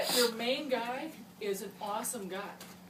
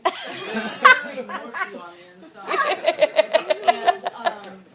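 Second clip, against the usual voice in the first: higher, louder and slow through laughter; laughing; 9 semitones higher, 18 dB louder, slower than usual. Real, not acted. Laughing toast line.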